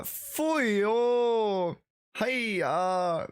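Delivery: high, long-drawn catchphrase exclamation; Uncle Roger. Acted. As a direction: draw it out high like a comic uncle. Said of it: "what the Uncle Roger".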